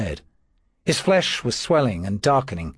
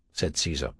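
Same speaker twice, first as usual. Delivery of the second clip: usual speaking voice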